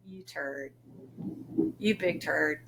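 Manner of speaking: low, soft mock insult; affectionate hushed scold. Mom quietly calling her son a turd. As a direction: scold him under your breath fondly.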